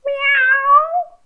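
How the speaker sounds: very high, drawn-out kitty meow; cat meow. Shown as a demonstration. Reading about a cat to a toddler, high meow. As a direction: mew like a little cat.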